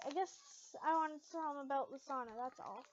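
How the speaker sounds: ordinary delivery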